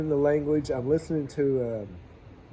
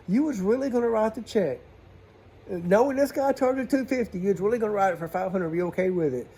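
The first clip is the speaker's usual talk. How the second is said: incredulous; 6 semitones higher than usual. Retold or imitated. Incredulous questioning of customer.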